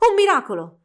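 grido di meraviglia, più forte e più acuto; stupito, quasi senza fiato; esclamazione senza fiato. Acted. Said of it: "disse l'inventore senza fiato". (l'inventore davanti alla bambola viva, meraviglia senza fiato)

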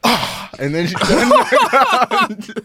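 high, laughing through it. Real, not acted. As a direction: crack up while telling. Laughing retelling rejection.

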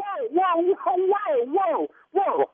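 rising alarm, whoa after whoa. Real, not acted. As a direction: pull back in shock at what you just heard. Alarmed by mention of body.

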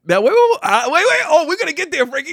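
high, loud eager interrupting; excited. Real, not acted. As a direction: hurry to cut him off.

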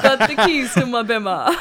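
giggly sing-song line; laughing playful rhyme. Acted. Joking rhyme.

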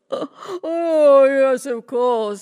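loud, slow boastful ogre; vain ogre. Acted. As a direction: bellow proudly like a vain giant. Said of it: "said the vain ogre".

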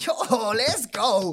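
slow, loud, high hype; hyped. Retold or imitated. Kid finally gets his first fight, loud excited cheer. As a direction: savour the yes like a win.

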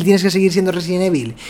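ordinary talk, nothing marked

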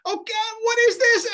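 high, loud shocked cry; loud alarmed disbelief; shocked dismay. Retold or imitated. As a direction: recoil at the strange package. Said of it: "I'm like".

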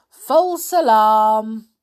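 loud, slow drawn-out call. Acted. Baloo tells the elephants it is safe, loud slow call. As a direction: stretch it out so the herd hears.

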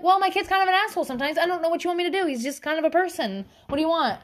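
high, quick acted protest; mock helpless bluntness. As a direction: rattle it off high, with put-on exasperation. Acted blunt reply about child.

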